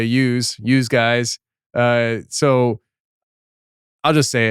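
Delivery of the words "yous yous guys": "yous" said like "use" with a z, Philadelphia style; "yous" with a long oo and buzzing z; Philly yous. Shown as a demonstration. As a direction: say "yous guys" as they do in Philadelphia. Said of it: "That's what I used to say in Philadelphia".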